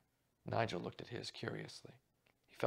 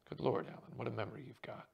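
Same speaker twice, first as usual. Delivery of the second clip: usual speaking voice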